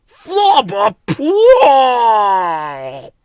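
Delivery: very slow, loud drawn-out yuck; disgusted gibberish. A narrator's reading. Bill hit by the stronger smell, loud disgusted gibberish. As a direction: recoil from the stench. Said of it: "said Bill".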